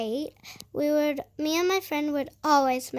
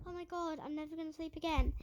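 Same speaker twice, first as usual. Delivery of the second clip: a much softer, rushed night-time panic; hushed mock panic; 12 dB quieter, faster than usual. Waking up at night, a hushed mock panic. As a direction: breathe it fast and hushed like you just woke.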